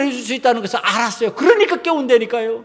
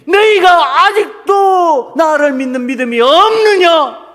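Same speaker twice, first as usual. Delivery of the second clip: loud, raised, slow stern rebuke; rebuking Jesus; 6 semitones higher, 5 dB louder, slower than usual. Retold or imitated. Jesus challenges the disciples' lack of faith, stern rebuke. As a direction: draw the question out with mounting force. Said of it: "예수님이".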